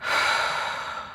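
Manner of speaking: soft, airy breath out; audible exhale. Shown as a demonstration. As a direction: release a quiet sighing breath. Models breathing out in meditation.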